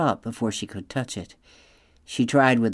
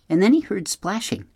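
plain reading voice

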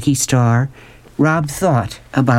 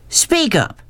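mock roar. A narrator's reading. Beauchamp demanding an answer from Rob, high mock roar. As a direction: roar it upward in pitch. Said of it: "roared Beauchamp".

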